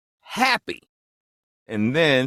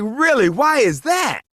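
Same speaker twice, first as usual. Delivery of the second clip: high, loud incredulous question; mock disbelief; 14 semitones higher, 3 dB louder than usual. Actor asking the stranger why, in mock disbelief. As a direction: act amazed and demand an answer.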